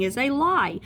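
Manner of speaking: loud, high-pitched verdict; emphatic debunking. Acted. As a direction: slam it down as a myth. Mocks bad pregnancy advice.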